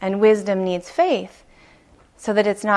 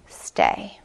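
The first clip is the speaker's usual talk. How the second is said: a low breathy whisper, barely voiced; whispered command. His whole system whispering him to stay put. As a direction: hush it like an inner voice.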